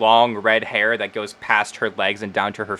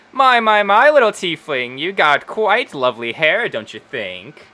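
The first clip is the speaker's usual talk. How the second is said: admiring halfling Wendy; 5 semitones higher, 3 dB louder than usual. Acted. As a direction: fuss over a customer's hair like a gushing hairdresser. Voices Wendy admiring tiefling's hair.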